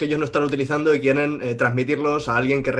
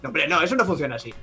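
ordinary delivery

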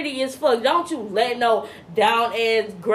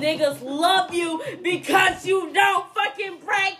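high, shouted punchline; shouting roast; 6 semitones higher than usual. Real, not acted. Podcaster roasting skinny haters, high shouted punchline. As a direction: yell the punchline for the laugh.

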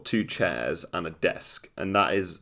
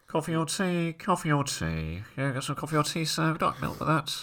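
higher-pitched trolley attendant voice; flight attendant; 9 semitones higher, 4 dB quieter than usual. Retold or imitated. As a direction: offer drinks down the aisle. Trolley attendant offering drinks.

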